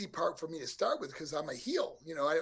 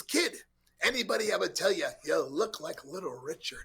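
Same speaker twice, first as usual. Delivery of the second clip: imitating Dusty. Dusty voiced in retold story.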